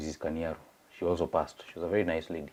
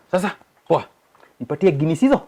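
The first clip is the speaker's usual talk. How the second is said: cheery bar order; 8 semitones higher, 6 dB louder, faster than usual. Retold or imitated. Ordering beers at the pub.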